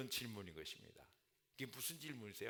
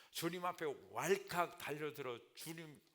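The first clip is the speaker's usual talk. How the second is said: usual speaking voice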